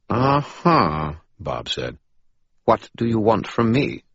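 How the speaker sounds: Bob voice. Acted. Bob's high voice.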